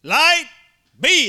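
high, loud proclamation; shouted divine command, pitched high; booming command. Shown as a demonstration. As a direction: ring it out like a decree. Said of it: "that's what he actually said in the Hebrew".

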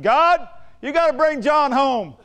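imitated loud, high, drawn-out cry to God; urgent plea. Man praying for John to come home, hammed-up urgent plea. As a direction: call it up to heaven, slow and emphatic. Acted urgent prayer in retold story.

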